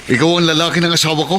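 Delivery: sharp, fast and high, accusing; quick accusing challenge; suspicious confrontation. Acted. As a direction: demand it like a jealous husband. Jealous husband confronts suspected rival.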